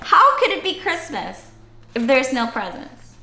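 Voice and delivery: ordinary delivery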